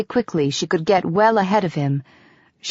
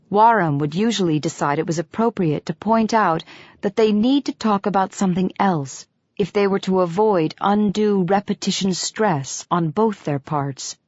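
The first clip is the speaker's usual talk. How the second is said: ordinary delivery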